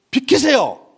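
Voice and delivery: acted roadside quarrel, loud and high; impatient driver. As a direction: bark it like a driver in a hurry. 승용차 운전자가 내려 길다툼.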